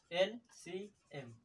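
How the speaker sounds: LCM drawn out slowly, letter by letter, soft; teacher dictating LCM slowly and quietly; slow spelled-out LCM. Shown as a demonstration. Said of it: "कहीं-कहीं देखे होंगे LCM".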